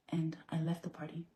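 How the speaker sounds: whispered, breathless. Real, not acted. Recalling leaving the party, whispering.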